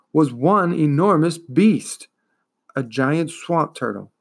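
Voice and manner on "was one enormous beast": ordinary delivery, nothing marked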